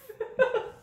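short high giggle; high laugh. Real, not acted. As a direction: burst out in a giggle. Laughs at own greeting. (高い笑い声)

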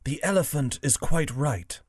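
a hint of a tapir character. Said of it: "said the tapir".

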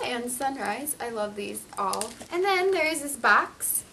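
ordinary talk

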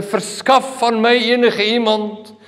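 high, slow, stilted formal appeal; hammed-up polite plea for rescue; mock fancy wording. Shown as a demonstration. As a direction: intone it like an elaborate announcement. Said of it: "dis nie met pragtige woorde".

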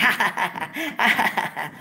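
hammed-up mocking cackle from the station staff; mock laughter. Staff mocking the guest on air, hammed-up fake laugh. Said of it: "bitches around the fucking station started laughing".

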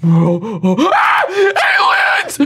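high panicked yell; alarmed shout. Real, not acted. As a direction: cry out in alarm. Aliens swarm in.

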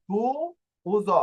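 তু, উ, জ said one by one; sounded out. Shown as a demonstration. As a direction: build the word from তু উ জ. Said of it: "এই ব্যাপারটা বুঝতে পেরেছেন". (ভেঙে ভেঙে উচ্চারণ)

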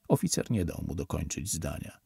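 plain reading voice

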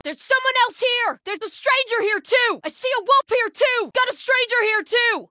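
high, loud enemy-sighting callouts; urgent, high-pitched battle cry of discovery; shouted alert. Acted. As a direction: yell to alert the others. Calling out sighted enemies.

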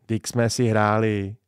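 plain reading voice

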